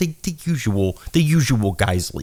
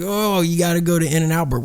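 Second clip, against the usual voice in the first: mock people gushing; 8 semitones higher than usual. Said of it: "They're like".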